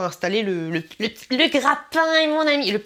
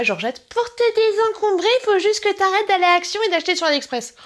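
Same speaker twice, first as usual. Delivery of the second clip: mocking viewer; 10 semitones higher, 4 dB louder than usual. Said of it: "Me dis pas".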